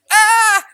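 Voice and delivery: sudden loud, high comic shriek; hammed-up scream of a horrified parent; mock scream. Mock panic at son's generosity.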